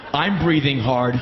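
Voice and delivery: normal talking voice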